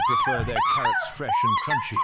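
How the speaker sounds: sudden distorted high screech with a laugh; glitchy shriek. Acted. Santa bot glitches into a screaming laugh while answering. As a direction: blast the line out in a broken squeal. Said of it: "that scared the fuck out of me".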